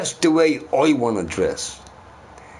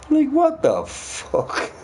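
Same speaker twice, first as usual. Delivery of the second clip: high, slow disbelieving outburst; incredulous outrage; 14 semitones higher, slower than usual. Real, not acted. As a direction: stretch it out in disbelief. Outraged at stoning gays.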